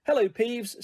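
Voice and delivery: ordinary delivery, nothing marked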